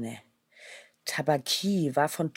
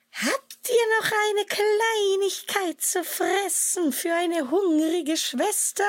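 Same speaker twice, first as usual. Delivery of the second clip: much higher, slow whimpering beggar voice; whining hyena. A narrator's reading. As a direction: whine for scraps like a fawning hyena. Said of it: "winselte die Hyäne".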